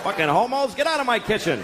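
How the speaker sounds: high indignant yelp, lightly fuming; hammed-up annoyed breakfaster; mock outrage. Man at breakfast scolding two guys, mock angry.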